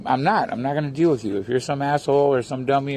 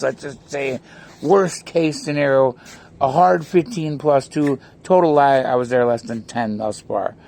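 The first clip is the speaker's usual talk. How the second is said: ordinary talk, nothing marked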